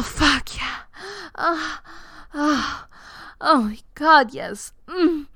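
high climaxing moans; moaning through climax; orgasm moans. Acted.

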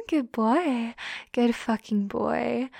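ordinary talk

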